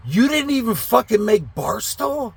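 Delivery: high-pitched incredulous question; angry disbelief. Retold or imitated. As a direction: re-enact exasperated disbelief at the driver. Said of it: "Now I'm fucking getting angry".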